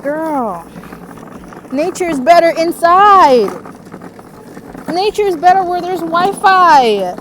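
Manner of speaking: high, loud diva voice; sassy bougie voice. Retold or imitated. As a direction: play the spoiled city girl. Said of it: "And I'm just like".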